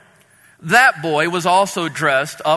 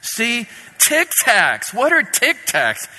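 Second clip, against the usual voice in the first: put-on whiny kid voice; 8 semitones higher than usual. A narrator's reading. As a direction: pout and hold up the box. Sad kid voiced in a story.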